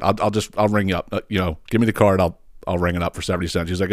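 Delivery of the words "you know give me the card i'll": ordinary delivery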